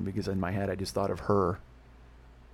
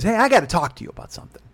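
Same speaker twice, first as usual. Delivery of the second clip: loud, higher, quicker mom voice; mock a mother announcing a talk, brisk and raised; imitated mother; 8 semitones higher, 6 dB louder, faster than usual. Retelling mother's phone call.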